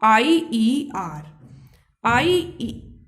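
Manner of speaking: letters drawn out one by one for dictation; a teacher slowly spelling a suffix; slow spelled-out letters. Shown as a demonstration. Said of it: "comparative degree बनाने के लिए क्या लगाएंगे".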